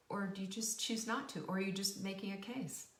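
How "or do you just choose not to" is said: ordinary talk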